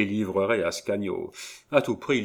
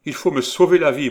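loud, high entreaty; urgent plea; 7 semitones higher, 9 dB louder, faster than usual. A narrator's reading. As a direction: beg for help as if in peril. Aubry begs his mistress for help. (supplication pressante, voix forte)

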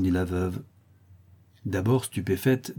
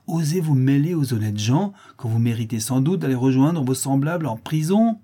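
loud, raised scolding; outraged denunciation; 5 semitones higher, 4 dB louder than usual. A narrator's reading. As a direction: condemn her with indignation. Mother continues denouncing the girl. (réprimande indignée)

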